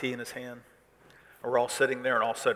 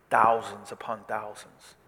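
ordinary talk

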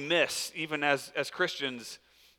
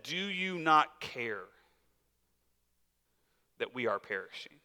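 quiet accusation; 4 semitones lower, 8 dB quieter than usual. Retold or imitated. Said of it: "It was an accusation".

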